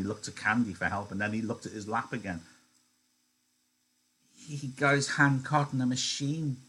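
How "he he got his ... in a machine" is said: higher, stumbling excuse; lightly voiced Lenny making an excuse; Lenny stammering.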